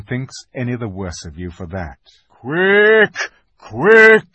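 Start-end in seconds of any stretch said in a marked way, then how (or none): 2.3-4.3 urgent high, loud shout; Aslan shouting. A narrator's reading. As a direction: shout an urgent command at full voice. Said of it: "shouted the voice of Aslan".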